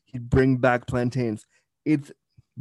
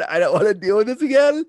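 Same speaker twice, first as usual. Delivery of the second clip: exasperated inner voice; 8 semitones higher, 5 dB louder than usual. Retold or imitated. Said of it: "being like".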